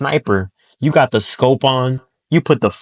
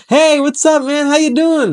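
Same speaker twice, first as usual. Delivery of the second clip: much higher, bright bubbly greeting; all happy; 4 dB louder than usual. Shown as a demonstration. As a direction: greet like an overexcited cheerleader. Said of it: "by being all happy".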